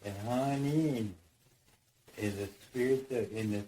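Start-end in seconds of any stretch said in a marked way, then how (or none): 0.3-1.1 "Hanin" said as HAH-neen, falling; "Hanin" pronounced hah-neen, a bit louder; Ojibwe word. Shown as a demonstration. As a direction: say "Hanin" with stress on the first syllable. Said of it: "we say".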